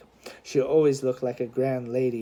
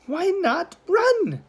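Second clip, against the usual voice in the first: slow, high-pitched protest; protesting boy; much slower than usual. A narrator's reading. Boy protesting to his sister, lightly voiced indignation. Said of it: "Charlie protested".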